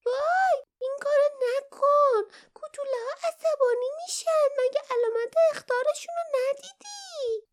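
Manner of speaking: very high, tearful pleading little creature voice. A narrator's reading. Perrin begs Barda not to strip the roof, high tearful plea. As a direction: beg him to stop, near tears. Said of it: "با عجله به سراغش رفت و التماس کرد".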